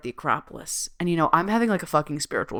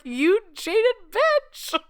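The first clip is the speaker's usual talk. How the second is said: high, slow, mock-outraged squeal; teasing insult; much slower than usual. Teasing her mom at the Acropolis, slow high squeal. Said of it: "I was like".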